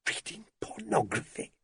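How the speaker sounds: high, hushed disbelief; incredulous. A narrator's reading. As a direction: echo it back softly, appalled. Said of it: "Hilda sounded incredulous".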